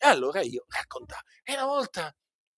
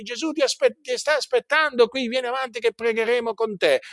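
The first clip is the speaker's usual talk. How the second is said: mock revival preacher; 9 semitones higher than usual. Mocking the preacher calling sinners to the altar, high and urgent. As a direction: push them forward like a pushy revivalist. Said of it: "le so a memoria perché le ho sentite".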